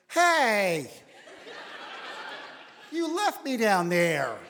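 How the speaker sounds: mock wife scolding; wife's complaint. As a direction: play the wife calling him out, slow and aggrieved. Wife calling him out.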